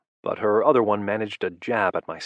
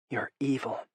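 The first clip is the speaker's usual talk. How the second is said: whispered; 4 semitones higher, 6 dB quieter than usual. A narrator's reading. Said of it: "she whispered".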